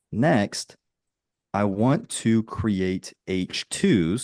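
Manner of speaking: drawn-out, word by word; slow dictation. Real, not acted. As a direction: dictate it slowly while typing. Dictating a prompt while typing.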